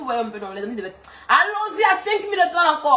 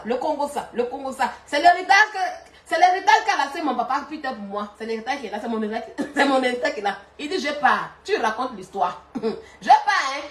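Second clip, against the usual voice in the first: ordinary delivery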